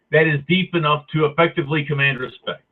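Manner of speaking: usual speaking voice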